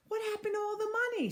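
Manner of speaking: high, fast hollered question. Acted. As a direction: holler it across the crowd in a rush. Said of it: "somebody hollered".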